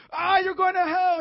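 high, drawn-out scream; hammed-up hellfire yell, slow and furious; upset angry preacher. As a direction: scream damnation like Doctor Angry. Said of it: "because you're so upset".

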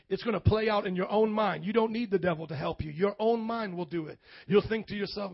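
ordinary talk

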